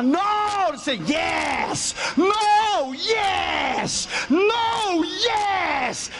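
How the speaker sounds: shouting match between no and yes; shouted back and forth. Retold or imitated. Tug of war between no and yes.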